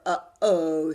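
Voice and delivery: loud startled dread. Acted. Arthur after the keyboard crashes, loud uh-oh. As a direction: realize the disaster.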